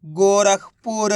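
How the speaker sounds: drawn-out model word; slow blended reading. Shown as a demonstration. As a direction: join the syllables but keep each one long. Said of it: "तो मिलके बन जाएगा". (अक्षर मिलाकर खींचकर पढ़ना)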